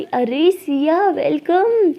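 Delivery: gushing, slow hello; excited welcome. Acted. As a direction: greet a friend with drawn-out delight. Acted excited greeting of friend.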